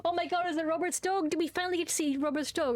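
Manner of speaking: high-pitched, eager rush; real excitement. Real, not acted. Player hopes to finally see Robert's dog, excited.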